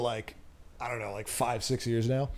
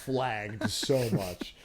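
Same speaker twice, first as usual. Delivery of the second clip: mock dismay; 5 semitones lower, 8 dB quieter, slower than usual. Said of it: "I'm like".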